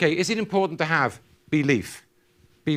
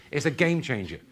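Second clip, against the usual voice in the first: ordinary talk, nothing marked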